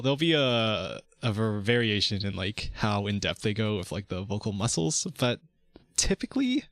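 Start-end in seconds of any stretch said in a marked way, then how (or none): 6.0-6.6 sudden high falsetto word; falsetto squeak. Acted. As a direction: pop it up into falsetto.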